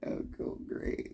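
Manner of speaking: whispered sarcastic aside; whispered mock praise. Real, not acted. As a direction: breathe it out mockingly under your breath. Sarcastic aside while ranting.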